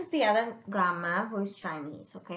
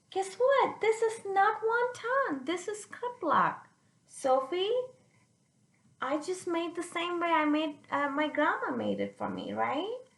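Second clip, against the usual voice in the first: higher, mock grandma setting it straight; 6 semitones higher than usual. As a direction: play grandma, pitched up. Retelling grandma's words.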